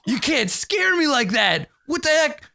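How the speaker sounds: overplayed terror at a tiny cat; hammed-up fear. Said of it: "trembling in fear".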